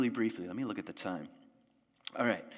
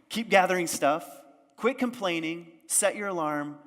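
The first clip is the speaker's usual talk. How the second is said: higher and louder, bossy villagers nagging; scolding villagers; 7 semitones higher, 7 dB louder than usual. Acted. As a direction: bark orders at the dreamer.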